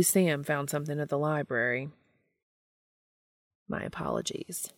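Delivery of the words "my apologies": soft, breathy murmured apology; muttered. Acted. Castiel to the woman in the hallway, muttering. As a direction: barely voice it, a whisper. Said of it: "Castiel mutters".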